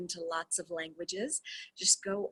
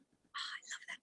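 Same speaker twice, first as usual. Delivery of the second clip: whispered aside; 11 dB quieter than usual. Real, not acted. Aside before rereading line.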